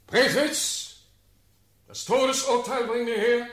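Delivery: loud, high-pitched summons; called-out command. Acted. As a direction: call out the order forcefully. Ruler calls out an order.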